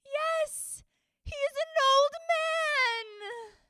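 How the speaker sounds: put-on high, slow, tearful girl; sadly. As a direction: cry out the words. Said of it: "Aino said sadly".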